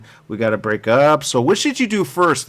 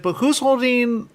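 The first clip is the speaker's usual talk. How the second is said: mock-confused, higher-pitched mother; 9 semitones higher than usual. Host retelling mother's question at the movie, mock confusion. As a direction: imitate a puzzled mother, pitched up. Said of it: "in her head got really confused".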